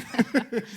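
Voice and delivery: short high giggle; high embarrassed giggle. Real, not acted. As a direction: laugh it off, embarrassed. Host laughs at own accent.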